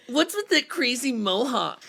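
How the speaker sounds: high, loud mocking jeer; teasing fish. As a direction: taunt the odd one out. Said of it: "I'll read responses as voices".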